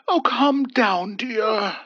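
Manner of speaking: soothing an upset wife, slow and high; calm her gently, old husband voice; gentle. A narrator's reading. Old husband soothing his upset wife, gentle. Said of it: "Hubert gently advised his wife".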